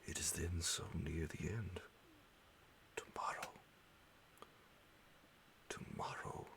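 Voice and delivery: usual speaking voice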